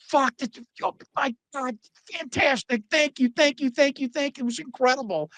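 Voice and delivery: retold giddy gratitude; imitated breathless excitement. Imitating DiBiase's frantic delighted gratitude.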